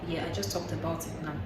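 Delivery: ordinary talk, nothing marked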